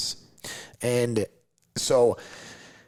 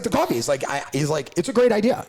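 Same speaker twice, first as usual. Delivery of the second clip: higher, louder excited client voice; 7 semitones higher, 4 dB louder than usual. Retold or imitated. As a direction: chirp it out high, thrilled about the coffee.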